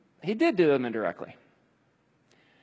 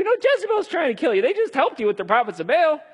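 exasperated retort; 12 semitones higher than usual. Acted. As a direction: throw the facts back at him.

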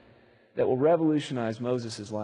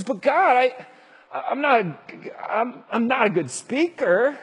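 high, stammering plea; pleading Moses; 9 semitones higher, 5 dB louder, slower than usual. Acted. Moses pleads with God that he cannot speak, high stammering plea. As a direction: beg to be let off, tripping over words. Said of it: "Moses says".

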